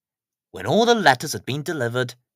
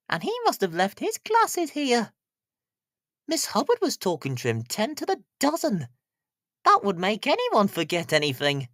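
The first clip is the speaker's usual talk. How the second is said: higher, chatty postmistress; Mrs Goggins gossiping; 7 semitones higher than usual. Acted. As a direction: tell the news like a village gossip. Narrator voices Mrs Goggins gossiping.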